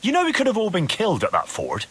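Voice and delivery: high-pitched, wounded outrage; indignant reproach. Acted. Confronts friend who betrayed him.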